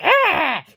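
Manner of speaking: high, loud imitated "ah"; short mock shriek of outrage; mocking screech. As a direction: imitate an affronted man's squawk. Imitating neighbor's noise and face.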